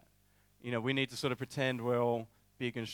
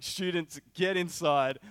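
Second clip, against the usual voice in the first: raised, higher urgent call; retold freak-out; 5 semitones higher, 5 dB louder, slower than usual. Youth leader urgently ordering students inside, panicked. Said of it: "I was freaking out".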